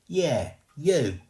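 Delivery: loud, high cockney retort; rough ghost boy. Acted. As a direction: insist bluntly. Ghost ordering girl.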